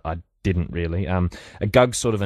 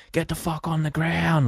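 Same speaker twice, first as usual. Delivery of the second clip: much higher, barked mock police order; police yell; 10 semitones higher than usual. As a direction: snap it like a cop storming in. Police storming in, yelling.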